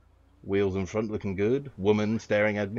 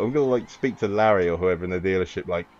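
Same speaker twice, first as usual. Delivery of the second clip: ordinary delivery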